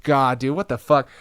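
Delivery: loud exasperated outburst, retold; retold fed-up complaint; pissed off. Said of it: "it would piss me off so bad".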